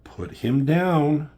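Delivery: slow, high, loud acted-out order; imitated urgent appeal to stop; urgent command. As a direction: plead loudly, drawing out each word. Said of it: "They're trying to tell her to calm down".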